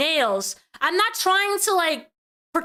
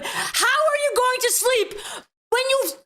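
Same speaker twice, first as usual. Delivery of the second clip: exasperated scolding; 8 semitones higher than usual. Real, not acted. Host berates guest.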